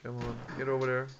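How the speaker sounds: ordinary delivery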